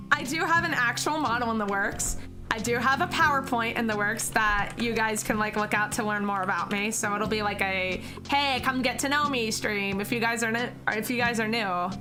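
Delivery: ordinary delivery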